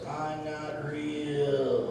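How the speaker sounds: very slow, drawn-out insistence; slow and deliberate. Acted. Dummy spelling it out to the ventriloquist, slow and deliberate. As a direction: stretch every word out for someone who won't listen. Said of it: "Read my lips".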